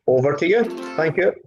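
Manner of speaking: ordinary talk, nothing marked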